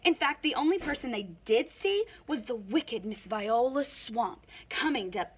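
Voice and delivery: usual speaking voice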